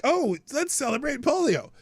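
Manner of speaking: high-pitched fake cheer; mock celebration. Host mocking the naming of disease days, mock-celebratory. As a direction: imitate an absurdly festive announcement. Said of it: "they make it sound like".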